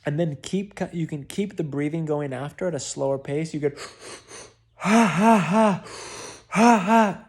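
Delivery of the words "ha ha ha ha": loud, high, forced ha ha bursts; forced laughter. Shown as a demonstration. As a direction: push the laugh out hard and loud. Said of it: "letting out the laughter".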